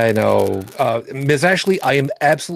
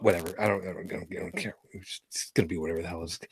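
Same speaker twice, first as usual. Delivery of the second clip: low, much softer, trailing away; resigned mumble; 7 semitones lower, 11 dB quieter, faster than usual. Real, not acted. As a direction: drop your voice and brush it off. Host gives up on the recap.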